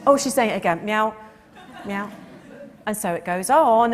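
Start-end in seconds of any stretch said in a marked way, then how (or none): 0.1-2.1 louder mock cat grumbling then meowing; the cat sighing at its chatty owner, then meowing; voicing the weary cat. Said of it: "the cat's sort of thinking".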